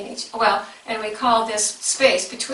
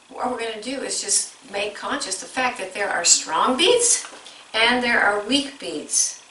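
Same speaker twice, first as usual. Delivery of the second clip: ordinary delivery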